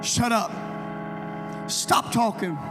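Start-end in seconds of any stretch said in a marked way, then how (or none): none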